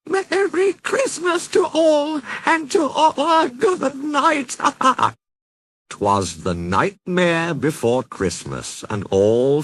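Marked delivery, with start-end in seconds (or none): 0.1-4.6 much higher, gleeful cackling cheer; Jack cackling. Acted. Jack calling out his greeting from the ghostly sleigh, cackling high. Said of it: "Jack cackled". 4.6-5.1 high gleeful villain cackle. Acted. Jack laughing as his sleigh takes flight, high cackle. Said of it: "Jack cackled".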